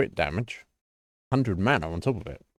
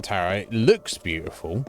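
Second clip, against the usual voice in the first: ordinary delivery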